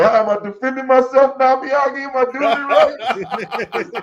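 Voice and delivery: whiny high-pitched Daniel. Retold or imitated. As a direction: squeak it out like a helpless student. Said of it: "would it be like".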